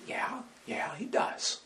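whisper. Real, not acted. Answering own question aside.

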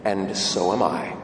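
low, quiet rabbi's reply; saintly old rabbi. Acted. Old rabbi to the traveling businessman, low quiet wisdom. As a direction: answer simply, dropping the pitch right down. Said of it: "Rabbi says".

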